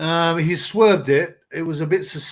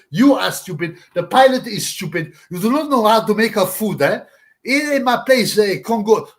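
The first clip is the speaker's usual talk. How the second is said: loud put-on rant about unseasoned food; mock ranting boxer; 3 semitones higher, 4 dB louder than usual. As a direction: ham up an outraged fighter on a plane. Impression of the boxer complaining about food.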